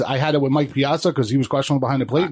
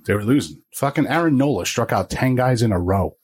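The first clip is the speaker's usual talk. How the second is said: normal talking voice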